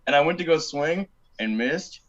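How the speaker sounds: ordinary talk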